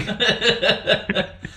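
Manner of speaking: high, airy laugh at her own joke; real laugh after telling the nurse joke. Real, not acted. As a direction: let a laugh burst out.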